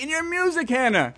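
normal talking voice

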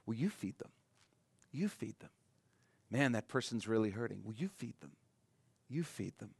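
very soft, gentle prodding; soft nudging Jesus. Acted. Jesus quietly urging us to help the hurting, hushed. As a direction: murmur it like a quiet challenge.

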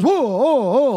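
high, loud wobbling whoas; mock alarm; startled driver. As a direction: imitate a driver swerving. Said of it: "And he goes".

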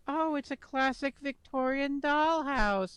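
high, slow, fussy mom voice; prim mother gushing over a classic; mom impression. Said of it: "I love your impression of your mom".

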